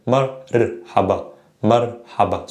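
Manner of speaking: "marhaba" with the R tapped like butter's T; butter-style ra. Shown as a demonstration. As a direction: say "marhaba" slowly, isolating the tapped R. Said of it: "the same ra with marhaba".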